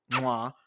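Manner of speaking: smacked kiss sound of approval; quick verbal kiss of praise; chef's kiss mwah. Real, not acted. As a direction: blow an appreciative kiss, mwah. Chef's kiss praise of her fashion.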